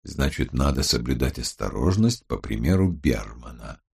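low-pitched, measured criminologist voice; Гаран. A narrator's reading. Criminologist advises the team to be cautious, low and measured. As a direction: drop the voice low for a sober warning. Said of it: "сказал Гаран".

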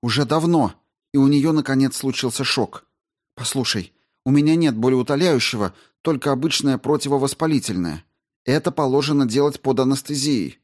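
high, brisk woman astrobiologist; Рао объясняет. Acted. Narrator voices Rao explaining. (высоким голосом, деловито и встревоженно)

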